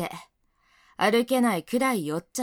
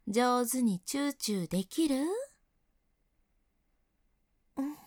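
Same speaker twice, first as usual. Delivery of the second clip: soothing mommy voice; 5 dB quieter than usual. Acted. Senior playing mommy to her crying junior, soft baby talk. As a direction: coo to him like a doting mother, hushed.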